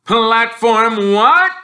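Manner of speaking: very loud, high, slow disbelief; outraged Uncle Vernon; incredulous. Acted. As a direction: bellow it in disbelief.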